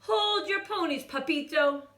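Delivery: high, loud little-dog voice; tiny chihuahua. A narrator's reading. Smallest chihuahua declaring to the kitten. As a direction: play the smallest of the pack. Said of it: "declared Paquito Tito".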